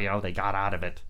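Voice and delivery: plain reading voice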